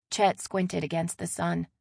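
usual speaking voice

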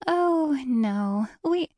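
high start gliding down, sugary; sugary denial, breaking off abruptly; sickly sweet. A narrator's reading. Guide hides indignation from passenger, sickly sweet, stopping short. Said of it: "behind a sickly sweet smile".

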